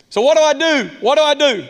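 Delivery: acted plea, high and loud; pleading questioner. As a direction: cry it out like someone desperate for an answer. Voicing an anxious listener's plea.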